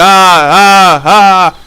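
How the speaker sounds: mock freak-out yelling in bursts; panicked wailing. Imitating himself freaking out over the teacher, loud wailing. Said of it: "So I started freaking out".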